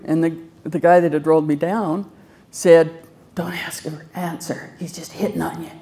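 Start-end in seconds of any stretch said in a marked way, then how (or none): 3.3-5.7 hushed aside. Retold or imitated. Retold joking orderly.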